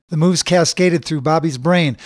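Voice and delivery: plain reading voice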